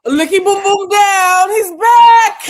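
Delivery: high, loud and slow, like a hype man; loud chant then a high yell; hype shout. Acted. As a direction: announce him like a ring entrance. Hype for enforcer returning.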